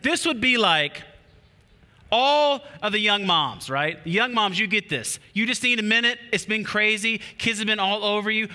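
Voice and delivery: ordinary talk, nothing marked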